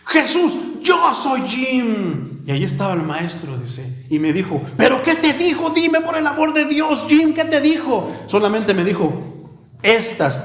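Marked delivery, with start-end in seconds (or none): 0.1-2.4 high, drawn-out call of the name; retold shout. Preacher retelling the master's cry, shouting slowly. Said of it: "él siempre gritaba tres veces". 4.8-8.1 high, pressing questions; eager plea. Acted. Congregant begging Jim to share, high and eager.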